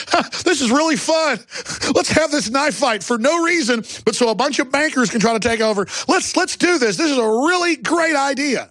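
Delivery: very high-pitched giddy idiot; high, silly, over-eager mockery; mock glee. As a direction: squeal with fake enthusiasm for a terrible plan. Mock glee about a knife fight.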